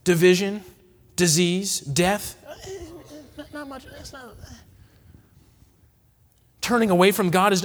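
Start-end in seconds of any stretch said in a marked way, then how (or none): 3.4-4.4 hushed dismissal. Pretend. Aaron's dismissive excuse.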